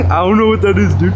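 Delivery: giggling while parroting him; laughing mockery. Real, not acted. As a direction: mimic him through a laugh. Mocking friend's line, laughing.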